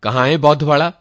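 a scornful official demanding an answer, loud; sharp nurse. Retold or imitated. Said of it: "फिर nurse बोली".

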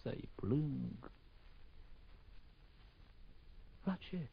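very soft after the outburst; drained grief. Acted. Collapse from cursing into mourning.

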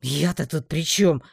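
slow, loud whine of protest; put-upon orc's whine; whining. A narrator's reading. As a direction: complain with drawn-out words. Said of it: "привычно проныл орк".

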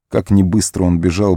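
usual speaking voice

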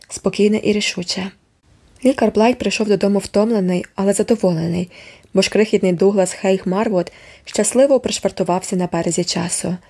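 plain reading voice

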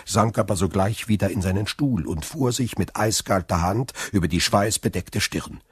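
usual speaking voice

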